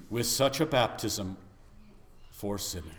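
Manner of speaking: trailing off. Acted. Voice drops after the congregation's rant.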